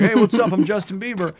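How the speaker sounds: loud, high, breezy celebrity greeting. Retold or imitated. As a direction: call it out like a cocky pop star.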